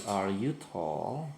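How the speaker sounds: slow, carefully spaced English question; teacher modelling a question for students, slow and clear; slow model sentence. Shown as a demonstration. Teacher shows pupils the question form, said slowly. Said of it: "Are-nya tinggal dikedepankan".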